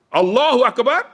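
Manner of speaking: high, projected prayer call; imam's takbir. Retold or imitated. Imam gives the next takbir, high projected call. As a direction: call it high, leading the rows.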